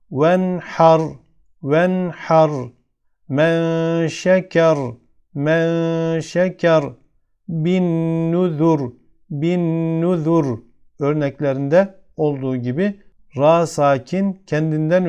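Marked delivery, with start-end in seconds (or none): 0.1-10.6 "Venhar, Men şeker, Bin nuzur" recited with a heavy, thick final r. Shown as a demonstration. Teacher shows sakin ra, "Venhar, Men şeker, Bin nuzur" with thick r. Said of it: "kalın okunur. Burada üstün okuyoruz".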